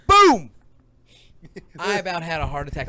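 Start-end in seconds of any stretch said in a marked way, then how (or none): 0.1-0.5 loud boom. Retold or imitated. Explosion in retold story.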